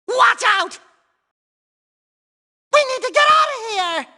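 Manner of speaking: very high, panicky cartoon yell; alarmed warning. Warning the others of danger ahead, alarmed yell. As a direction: cry the warning out high and urgent.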